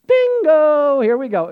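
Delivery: loud, high whoop of triumph; excited cheer. Retold or imitated. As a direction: shout it like you just won. Excited at getting to preach.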